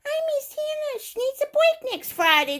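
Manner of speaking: high-pitched toy whimper; make-believe tearful complaint; pretend crying. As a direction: play a sad little toy. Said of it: "you go".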